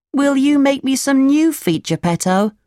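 high little puppet voice, asking a favour; high-pitched storybook puppet request; pleading puppet. A narrator's reading.

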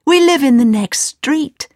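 put-on high, cheerful boy voice; happy. Aladdin tells his new uncle where he lives, happy. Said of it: "said Aladdin happily".

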